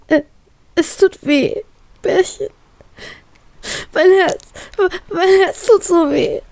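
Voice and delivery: high, crying whimper that breaks; tearful whimpering. Acted. Heartbroken girl tells her Bärchen on the phone, sobbing. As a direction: whine it out through tears. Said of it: "jammere ich".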